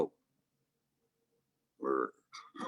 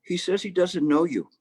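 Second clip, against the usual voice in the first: cheeky punchline; 8 semitones higher than usual. Acted. Punchline, monk's reply.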